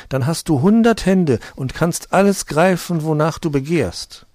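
high, loud grasping demon; shrieking demon. Acted. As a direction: shout it like a clutching demon. Third demon tempts Mantau.

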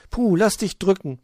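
high, loud affection; delighted. Acted. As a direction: exclaim happily before a hug. Delighted Luzi hugs Puh.

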